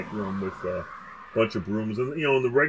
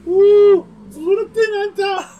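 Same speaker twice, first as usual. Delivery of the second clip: much higher, louder laughing squeal; laughing hard; 13 dB louder than usual. Retold or imitated. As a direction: squeal it through the giggles. Repeating the line, cracking up.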